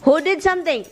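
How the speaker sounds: high, accusing question; scolding pets. Real, not acted. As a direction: demand a culprit in a high pet-scolding voice. Questioning pets over a mess.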